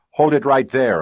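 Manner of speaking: loud, high barked halt; cop command. Acted. Police officer halting the chase, loud command. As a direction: stop him cold like a cop. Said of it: "It was a cop voice".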